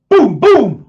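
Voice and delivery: loud, high mock booms; sonic boom imitation. Recalling jets over Washington, loud imitated booms. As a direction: belt out two booms like jets breaking the sound barrier.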